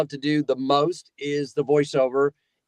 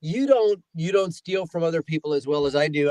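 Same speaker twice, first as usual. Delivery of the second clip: ordinary talk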